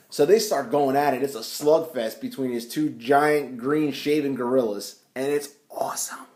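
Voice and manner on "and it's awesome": 1 whispered delight. Real, not acted. Gleeful aside on the fight.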